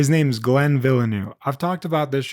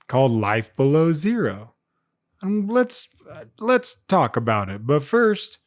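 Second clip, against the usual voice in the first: usual speaking voice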